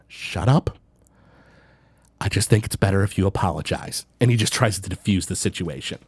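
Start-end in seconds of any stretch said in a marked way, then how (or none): 0.0-4.0 weary, softer Longinus. Acted. Longinus defuses situation.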